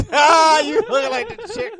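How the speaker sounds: loud high laugh through the words; laughing loudly. Real, not acted. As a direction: crack up while teasing. Laughing hard at friend.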